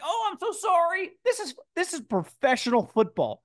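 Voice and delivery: high, whiny mock-apology voice. Host mocking the coach's apology, whiny and high. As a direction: mimic a sheepish coach apologizing. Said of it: "And you're like".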